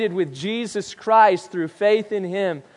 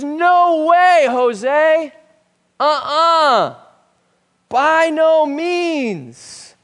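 high, loud, slow no way; emphatic refusal; 7 semitones higher, 6 dB louder, much slower than usual. Retold or imitated. Paul answers the objection with a loud emphatic no. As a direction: reject it flatly, full force. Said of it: "in emphatic terms".

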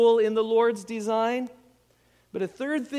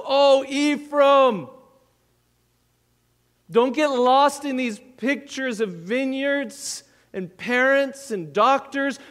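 higher and louder heartfelt plea; pleading cry; 5 semitones higher, 6 dB louder than usual. Acted. Preacher voicing the Lord pleading with Ephraim, higher and louder. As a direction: cry out like a longing father. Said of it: "he's crying out from his heart".

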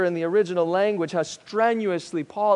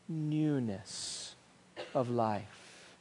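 savoring the word; 9 semitones lower, 16 dB quieter, much slower than usual. Real, not acted. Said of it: "I love that word".

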